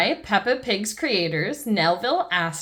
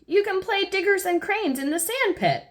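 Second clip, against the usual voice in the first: Mummy Pig soothing; 11 semitones higher than usual. Acted. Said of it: "says Mummy Pig".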